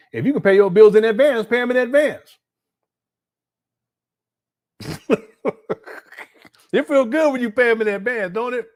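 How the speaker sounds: hyping up paying bills in advance; excited emphasis. Real, not acted. Host urging viewers to pay bills early, excited.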